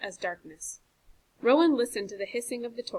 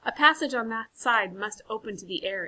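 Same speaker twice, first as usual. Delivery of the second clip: usual speaking voice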